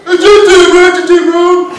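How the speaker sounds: high, loud, strained yell; hammed-up cry of agony; pained shout. Patient in pain bursting into the emergency room, hammed-up pained shout.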